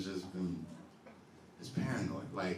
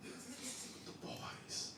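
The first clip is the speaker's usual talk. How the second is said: whisper; 14 dB quieter, much slower than usual. Retold or imitated. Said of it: "It was like".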